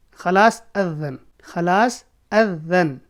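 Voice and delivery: very slow, careful model phrase; slow demo. Shown as a demonstration. Teacher slowly modelling "the azan is done". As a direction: draw each word out for the class. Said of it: "تو آپ بولیں گے".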